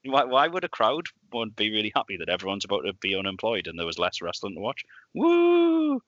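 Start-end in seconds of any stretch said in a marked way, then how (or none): 5.1-6.0 very high, loud sarcastic whoop; mock cheer. Podcaster mocking a crowd cheering WCW's death, a loud sarcastic whoop.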